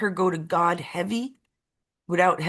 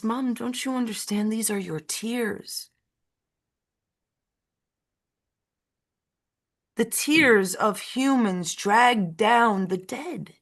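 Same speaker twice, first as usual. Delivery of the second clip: gentle daughter voice; 2 semitones higher, slower than usual. Acted. Daughter answers her mother.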